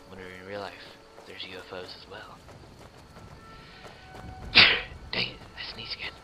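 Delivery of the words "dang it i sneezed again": quick breathy whisper under the breath; quick hushed aside. Real, not acted. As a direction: whisper it fast, embarrassed. Sneeze aside.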